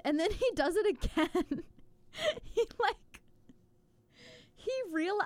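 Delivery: high, helpless laugh breaking up the words; laughing while talking. Real, not acted. Cracking up retelling the film to a co-host, laughing through the words. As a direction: try to get it out through real laughter.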